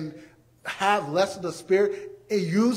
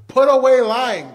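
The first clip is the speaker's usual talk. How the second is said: louder preacher's command; forceful command; 4 semitones higher, 9 dB louder than usual. Acted. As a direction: drive the command home to the congregation.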